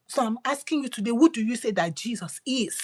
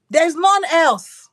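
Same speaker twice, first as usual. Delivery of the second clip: proclamation; 6 semitones higher, 9 dB louder, much slower than usual. Acted. God's words proclaimed loudly, slowly.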